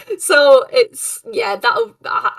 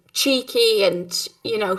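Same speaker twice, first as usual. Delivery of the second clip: usual speaking voice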